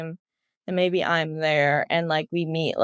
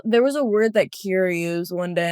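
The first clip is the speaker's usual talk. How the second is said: usual speaking voice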